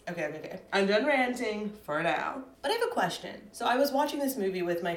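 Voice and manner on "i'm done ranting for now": ordinary talk, nothing marked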